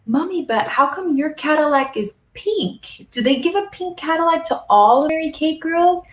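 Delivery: a hint of a curious small child; little son asking. Mom retells her son asking about the car, lightly childlike voice. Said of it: "the other day he goes".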